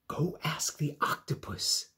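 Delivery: whispered starfish advice. Acted. Starfish character voice.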